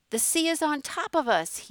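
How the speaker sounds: high, loud panicked scream; terrified scream. A narrator's reading. Man diving behind bench, terrified scream. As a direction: scream it as the wave hits. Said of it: "he screamed".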